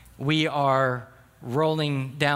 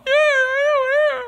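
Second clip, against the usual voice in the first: put-on very high, louder childish yeah; little girl; 10 dB louder, much slower than usual. Daughter admitting to dad she did wrong, high childish voice. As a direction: answer like a sulky small child.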